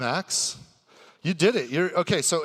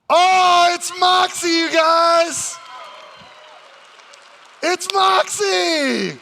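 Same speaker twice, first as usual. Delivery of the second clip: excited reveal; 11 dB louder, much slower than usual. Acted. Host hypes a surprise guest's entrance.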